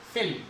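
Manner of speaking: फिल with a short i, low and unstressed; short vowel. Shown as a demonstration. Teacher repeating the short sound example, short vowel. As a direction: say फिल briefly, lax vowel. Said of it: "short sound".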